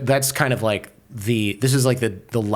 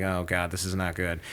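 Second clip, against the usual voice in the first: low, much softer worried aside to himself; muttered dread; 5 semitones lower, 10 dB quieter than usual. Retold or imitated. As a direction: mutter it under your breath, sensing danger. Muttering dread under his breath.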